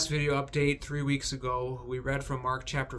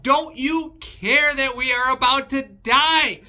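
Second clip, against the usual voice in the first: much higher, louder, frantic cry; panicked exclaim; 11 semitones higher, 10 dB louder than usual. Retold or imitated. Disciples waking Jesus in the storm, panicked cry. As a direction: plead in terror as the boat sinks. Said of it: "they exclaim".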